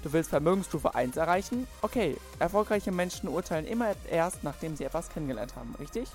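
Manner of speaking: normal talking voice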